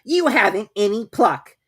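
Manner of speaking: high, slow scolding Rabbit; a fussy rabbit chiding; Rabbit's voice. A narrator's reading.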